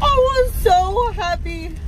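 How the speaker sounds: squealed high and slow; giddy, high-pitched happiness; overjoyed. Real, not acted. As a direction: let the relief burst out in a high, stretched squeal. Relief after getting only a warning.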